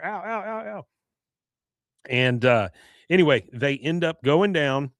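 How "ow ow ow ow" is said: high, quick "ow, ow, ow"; string of little high mock ows; imitated yelps of pain. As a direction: yelp in mock pain, pitched up. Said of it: "so we hear".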